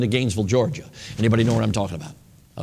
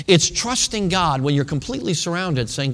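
ordinary talk, nothing marked

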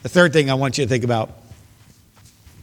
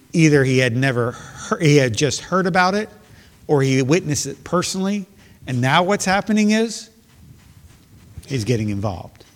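ordinary talk

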